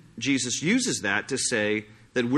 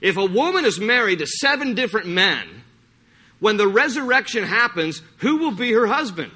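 mock Sadducees; 8 semitones higher, 4 dB louder than usual. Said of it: "they're like".

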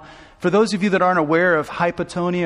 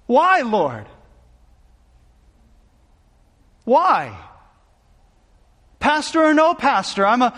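a desperate father's retold prayer, raised and strained; anguished prayer; 4 semitones higher than usual. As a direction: cry out why to the Lord in retold anguish. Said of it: "crying out to God".

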